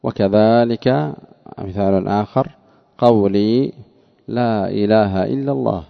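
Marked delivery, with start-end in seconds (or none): none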